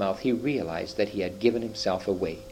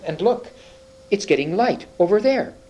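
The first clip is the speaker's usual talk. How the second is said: excited; 9 semitones higher, 4 dB louder than usual. Acted. Susan sees daylight.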